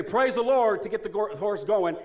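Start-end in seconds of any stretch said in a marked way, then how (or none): 0.0-0.8 higher and louder preacher's call; preacher's command. Retold or imitated. Preacher commanding his horse to go, called out loud. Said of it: "So he would say".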